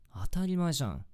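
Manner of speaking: indignant. Acted. Offended she doubted him.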